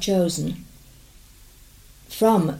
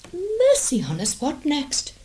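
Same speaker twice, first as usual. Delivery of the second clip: much higher, alarmed lady; 8 semitones higher than usual. Acted. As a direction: cry it out in alarm.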